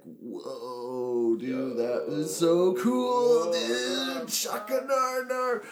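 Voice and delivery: slow, high, drawn-out amazement; tripping-out stoner. Retold or imitated. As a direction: stretch it out like a blissed-out stoner. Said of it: "he was tripping out a little hard".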